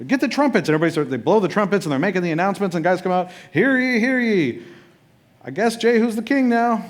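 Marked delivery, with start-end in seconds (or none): 0.1-0.7 high, slow, delighted gasp; the crowd suddenly thrilled at the news; mock-excited townsfolk. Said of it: "everybody's like". 3.5-6.9 high, loud herald proclaiming; hammed-up herald announcement; town crier.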